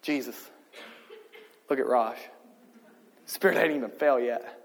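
a hint of a fond father, soft and low; lightly voiced God, chuckling as he points out the dancer; delighted God the Father. God the Father to the Son, softly amused. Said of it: "God the Father looks at God the Son and says".

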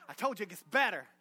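ordinary delivery

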